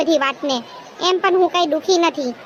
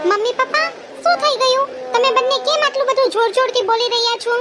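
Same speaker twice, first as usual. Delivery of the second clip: put-on young daughter voice; 5 semitones higher than usual. Child woken by parents' quarrel.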